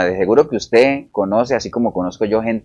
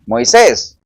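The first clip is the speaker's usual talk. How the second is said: high-pitched, mock summons to Moisés; imitated calling out; 10 semitones higher, 4 dB louder than usual. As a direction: call the name high, as God addressing him. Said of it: "no creo que le haya dicho así también". (llamado agudo imitado)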